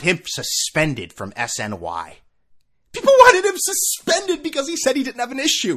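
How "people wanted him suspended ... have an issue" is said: high, loud squawk of disbelief; outraged high-pitched repeat; incredulous outburst. Real, not acted. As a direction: yell it high in exasperated disbelief. Incredulous at outrage over announcer.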